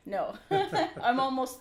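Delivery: high burst of real laughter; embarrassed laughing. Real, not acted. Embarrassed laugh at age joke.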